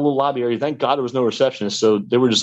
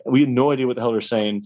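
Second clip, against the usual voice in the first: usual speaking voice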